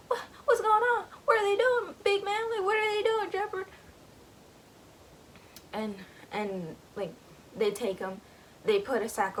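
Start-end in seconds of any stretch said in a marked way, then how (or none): 0.1-3.7 high, loud frightened cries; panicked pleading. Retold or imitated. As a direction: squeal it out like a scared kid begging. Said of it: "And Gussie's like".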